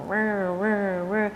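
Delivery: mechanical toy whirring noise; Energizer Bunny whir. Retold or imitated. Mocking linear yogis as a buzzing toy bunny. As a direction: buzz like a wind-up bunny running.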